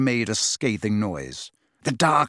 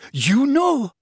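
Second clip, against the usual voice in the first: outraged disbelief; 12 semitones higher, 5 dB louder than usual. A narrator's reading. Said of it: "look of outrage".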